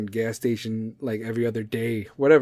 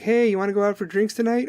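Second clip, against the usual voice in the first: high-pitched, casual mock invitation; imitated chirpy small talk; straight-faced invite; 8 semitones higher than usual. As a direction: act out a breezy drinks invite. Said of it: "coming at me with a straight face and being like".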